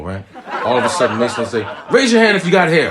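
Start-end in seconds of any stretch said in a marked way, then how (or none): 1.9-2.9 high, loud laughing challenge; taunting son. Retold or imitated. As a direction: call it out like a cheeky kid.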